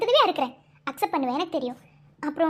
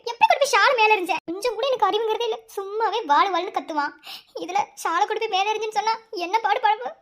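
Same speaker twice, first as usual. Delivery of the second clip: ordinary delivery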